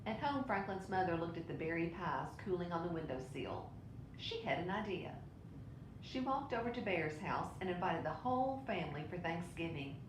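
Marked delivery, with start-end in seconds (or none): none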